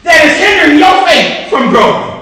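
full-force gospel preaching; fiery preaching. Acted. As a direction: preach it out to the congregation. Preaching the Shamgar story.

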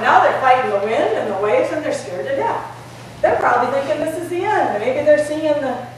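usual speaking voice

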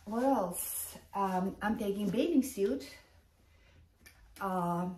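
usual speaking voice

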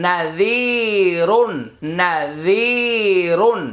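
নাজিরুন with a very long জি; slow recitation, drawing out the middle of নাজিরুন; madd stretch. Shown as a demonstration. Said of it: "এই লাইনে পেয়ে গেলাম".

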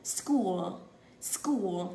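School with the u drawn out long; long u sound. Shown as a demonstration. Teacher showing pupils the vowel, School with a long u. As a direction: stretch the u in School. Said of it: "Âm u kéo dài ra".

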